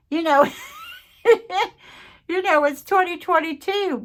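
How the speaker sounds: high, loud giggly chatter; bubbly laughing aside. Real, not acted. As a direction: laugh while you talk to viewers. Laughing playful sign-off.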